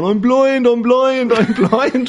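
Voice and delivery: imitating a panicking blinded friend; panicked cry. Retelling Robbie's panic to the host, high mock wailing. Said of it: "he was going around going".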